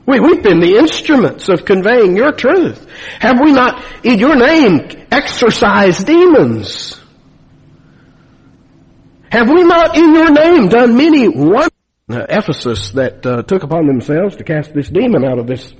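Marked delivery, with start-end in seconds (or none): 0.0-11.7 rising, ever more insistent plea to the Lord; false prophets protesting at judgment, rising plea; pleading complaint. Retold or imitated. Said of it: "Look at their complaint".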